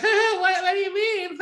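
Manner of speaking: high-pitched mock nervous laugh; nervous giggle. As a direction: imitate an uneasy giggle around the words. Caller laughs nervously at odd question.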